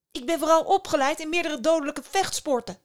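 high, loud, hurried-sounding warning; alarmed bluff. A narrator's reading. Startled girl threatens the stranger in her bedroom, alarmed bluff.

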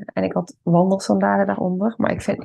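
ordinary delivery

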